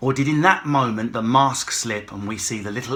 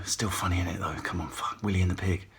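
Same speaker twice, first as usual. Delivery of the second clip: much lower, quieter aside; muttered aside; 9 semitones lower, 9 dB quieter than usual. Real, not acted. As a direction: mumble it to yourself.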